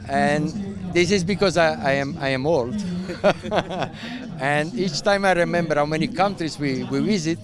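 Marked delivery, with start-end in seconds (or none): 2.8-4.3 real laugh, higher in pitch; high, rolling chuckle. Real, not acted. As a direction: laugh at your own age. Laughing at being old.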